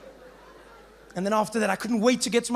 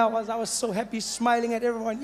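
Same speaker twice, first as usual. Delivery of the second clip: ordinary delivery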